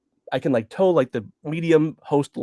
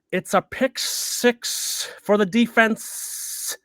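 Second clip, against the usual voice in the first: very slow mock sportscaster, stretching the S sounds; drawn-out broadcaster; 6 semitones higher, much slower than usual. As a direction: parody a TV football announcer. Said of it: "These drawn out S's".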